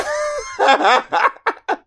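high burst of laughter. Real, not acted. Cracking up at friend's bit.

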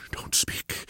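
hushed urgent warning; urgent whisper. A narrator's reading. Sick man warns hidden friend, whispered. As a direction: breathe the order, almost no voice. Holmes hushing hidden Watson.

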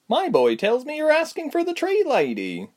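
chatty innkeeper's wife opening the topic. A narrator's reading. The innkeeper's wife asking the guests, high motherly voice.

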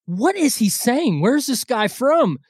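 put-on wife baffled by the accent; puzzled Amy. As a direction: mimic a confused partner. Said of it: "Amy's like".

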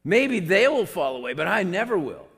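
loud, high, emphatic boast; boastful vow. Retold or imitated. Peter vowing he will never fall away, loudly boastful. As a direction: declare it with full self-assurance.

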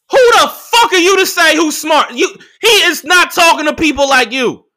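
loud, high-pitched indignant challenge; indignant retort. Retold or imitated. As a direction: fire back, outraged at the nerve. Angry retort to coworker's remark.

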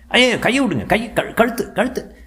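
high, strangled gasping plea; choking. A narrator's reading. Henchman choking in a collar grip, high strangled cry. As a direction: gasp it out with a hand on your throat. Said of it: "மூச்சு திணற".

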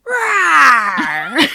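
loud roaring monster cry; monster roar impression. Shown as a demonstration. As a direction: roar like a suit actor monster. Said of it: "this is my impression of it".

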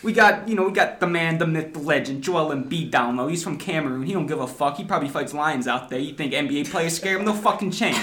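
hammed-up Philly accent, sports fan bragging. Podcaster hypes the Sixers on request, mock Philly accent. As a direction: put on your best brash Philly accent. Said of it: "give it to us in your best Philly accent".

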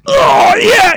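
mock sex yell, far too loud for flatmates; loud moaning yell. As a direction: belt it out like a climax in the next room. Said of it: "I'd be like".

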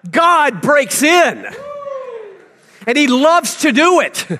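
rousing sermon delivery; fiery preaching. Acted. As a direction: preach it big to the congregation. Preaching to congregation.

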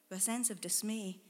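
usual speaking voice

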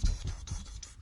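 voiceless puffing footstep noises; imitated running steps. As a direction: puff out quick soft footfalls.

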